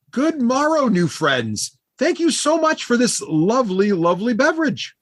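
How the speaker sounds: high-pitched, effusive halfling greeting; cheery halfling bard. Acted. Halfling bard thanking new friends for a drink, high effusive voice. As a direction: play a gushing little bard, pitched high.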